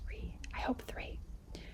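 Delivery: hushed aside; whisper. Real, not acted. Teacher whispering hope to students. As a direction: whisper it hopefully. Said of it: "teacher whispers aside".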